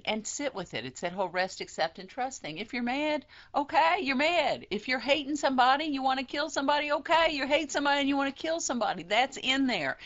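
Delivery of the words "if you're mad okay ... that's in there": impassioned. Real, not acted. Urging acceptance of anger.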